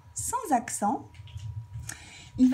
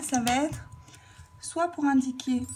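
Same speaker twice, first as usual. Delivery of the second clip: ordinary talk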